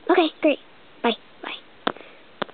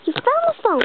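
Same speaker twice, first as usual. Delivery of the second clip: excited praise; 9 semitones higher than usual. Pretend. Praising Mimi for finding phone.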